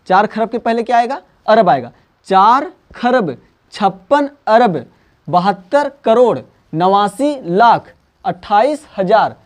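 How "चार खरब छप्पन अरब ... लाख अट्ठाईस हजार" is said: teacher spelling out a big number slowly for students; slow spaced reading. Shown as a demonstration. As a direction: read the number slowly, chunk by chunk. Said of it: "इस तरीके से आप पढ़ लोगे".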